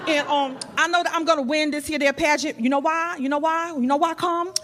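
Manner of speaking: lightly voiced brash Brooklyn accent; a hint of a tough Brooklyn contestant; Brooklyn girl accent. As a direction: sass the crowd in a Brooklyn accent. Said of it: "I'm from New York".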